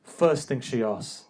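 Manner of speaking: ordinary talk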